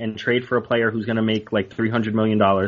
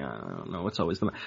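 resigned; 8 semitones lower, 11 dB quieter, faster than usual. Retold or imitated. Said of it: "I was like".

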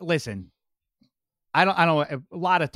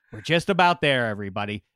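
ordinary talk, nothing marked

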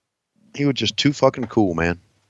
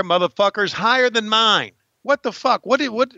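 mock indignation; 10 semitones higher than usual. Complaining about the chair on phone.

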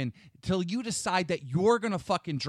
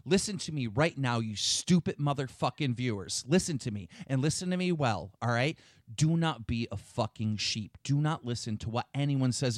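low-pitched stern sermon to viewers, a hint of a preacher; stern lecture; 4 semitones lower than usual. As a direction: lay down the law to the viewers.

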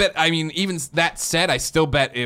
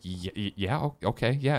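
soft, stammering, nervous yes; starstruck stammer; 2 semitones lower, 7 dB quieter than usual. Retold or imitated. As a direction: stutter out a shy yes like meeting your heroes. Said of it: "I remember being starstruck".

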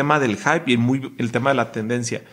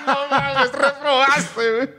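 laughing; 12 semitones higher, slower than usual. Real, not acted. Laughing at the failed accent.

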